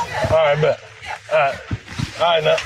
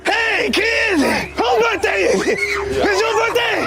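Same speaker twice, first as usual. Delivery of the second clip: party clown; much slower than usual. Acted. Homie the Clown greeting the kids at a party, high slow shouting. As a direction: boom it out like a creepy party clown. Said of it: "to be Homie the Clown".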